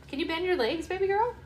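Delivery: high-pitched coaxing. Real, not acted. Mother asks her baby girl to bend her legs, high baby talk.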